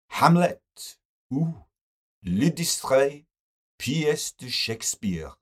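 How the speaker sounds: high, slow, exaggerated French pronunciation; affected French accent quoting a poster; French quote. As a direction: savour the French title with relish. Best quotes French title.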